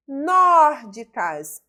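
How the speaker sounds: "Nórdicas" with a long, loud NÓR; stressed syllable demonstration. Shown as a demonstration. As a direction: punch and hold the NÓR in "Nórdicas". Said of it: "aqui é uma proparoxítona".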